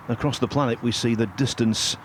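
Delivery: ordinary delivery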